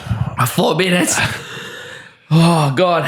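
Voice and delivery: high, strained dismay; can't believe the wait; pained disbelief. Real, not acted. Eating a habanero, in pain.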